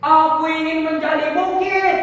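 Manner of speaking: high, loud, sustained declaration; seruan tekad. Acted. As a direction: proclaim the wish at full voice. Character declares a new wish. (berseru lantang penuh tekad)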